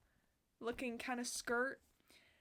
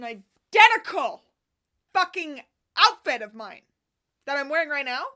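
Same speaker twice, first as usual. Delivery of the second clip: loud, thrilled outburst; excited shout; 3 semitones higher, 17 dB louder than usual. Real, not acted. Vlogger thrilled her vision board outfit came true, loud. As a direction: shout it out in delight.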